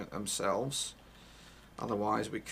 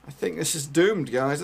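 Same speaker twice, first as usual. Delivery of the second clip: loud despair; 4 semitones higher, 9 dB louder than usual. Real, not acted. Despairing at the match.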